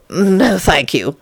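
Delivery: plain reading voice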